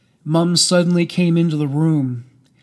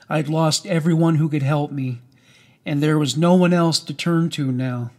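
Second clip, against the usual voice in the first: ordinary delivery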